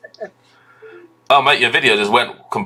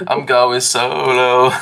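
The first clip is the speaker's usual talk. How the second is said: laughing; much slower than usual. Acted. Laughing repeat of solo.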